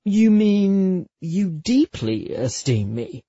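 hammed-up breathless admirer; mock wonder. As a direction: ask it with drawn-out, playful astonishment. Mock-formal teasing of lover.

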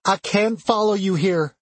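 high, distressed pleading voice; crying. A narrator's reading. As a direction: plead with your mother, pitched high. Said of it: "she cried".

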